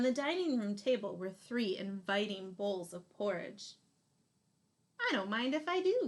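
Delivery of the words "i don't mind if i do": lightly voiced cheeky girl, higher and quick; a quick, higher, cheeky little girl; Goldilocks. Goldilocks helps herself to the biggest bowl, lightly voiced cheeky girl. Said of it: "said Goldilocks".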